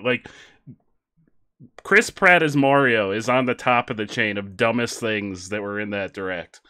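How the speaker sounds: ordinary talk, nothing marked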